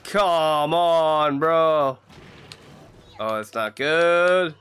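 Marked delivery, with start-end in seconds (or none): none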